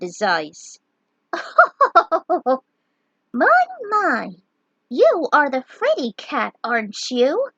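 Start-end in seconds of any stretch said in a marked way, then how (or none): 1.3-2.6 high loud teasing laugh; mocking laugh. Shantotto laughing at the frightened knight, mocking. 3.3-7.5 high loud sing-song teasing; teasing mock voice. Shantotto mocking the fraidy cat knight, high and teasing.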